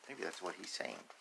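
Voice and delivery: ordinary delivery, nothing marked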